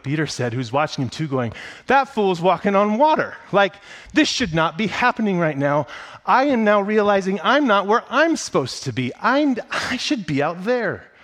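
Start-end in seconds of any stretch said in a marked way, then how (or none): 1.9-11.1 high, loud, worked-up disciple blurting out his envy; envious exclamation. Retold or imitated. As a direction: shout it like a jealous friend watching from the boat.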